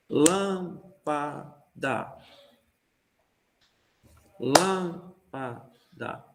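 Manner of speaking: "Lâmpada" very slow in three beats, LÂM... pa... da; stressed first syllable. Shown as a demonstration. Teacher demonstrating ternary pulse with a word, first syllable stressed. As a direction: draw out "Lâmpada" syllable by syllable, first strong, the others weaker. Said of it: "ela é mais forte".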